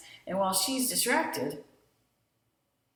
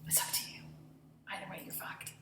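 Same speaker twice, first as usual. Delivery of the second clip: much softer whispered threat; menacing whisper; 13 dB quieter than usual. Retold or imitated. Harvey's closing threat to Faye, a menacing whisper. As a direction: breathe the threat low and cold.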